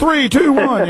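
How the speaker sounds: mock countdown. Mocking the radio countdown.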